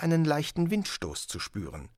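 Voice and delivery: usual speaking voice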